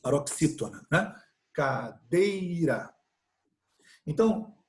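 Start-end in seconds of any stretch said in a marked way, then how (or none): 1.5-2.9 "cadeira" very slow, the middle syllable "dei" held long; slow demonstration of "cadeira" with a lengthened second syllable; stress on DEI. Shown as a demonstration. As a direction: lean on "dei" in a drawn-out "cadeira". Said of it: "qual é a sílaba tônica".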